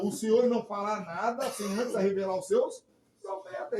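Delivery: preacher quoting scripture at full force; preaching. Acted. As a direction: proclaim the verse to the crowd.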